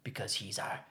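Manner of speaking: very low, hushed solemn line; Kamishi. Retold or imitated. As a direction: say it deep and soft, like a film's closing line. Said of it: "Kamishi's like".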